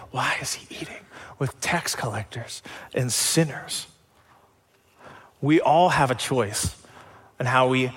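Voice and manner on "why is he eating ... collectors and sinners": hushed, scandalized church-lady whisper. Retold or imitated. Religious people clutching pearls, whispering scandalized. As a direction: gasp it behind your hand, shocked.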